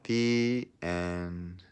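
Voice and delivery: put-on lively read-aloud voice for children; storybook narration. Teacher reading a picture book to her class, storybook narration. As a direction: tell the story to a class of kids.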